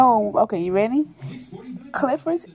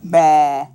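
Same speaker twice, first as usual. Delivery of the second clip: low, drawn-out bleat; sheep baa; 8 semitones lower, 4 dB louder than usual. Acted. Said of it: "the sheep says".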